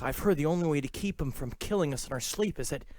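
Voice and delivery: hushed, dropping into a whisper; mock spooky come-on. Ghost hunter sweet-talking a date in the graveyard, hushed mock dread. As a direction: tail off into a conspiratorial whisper.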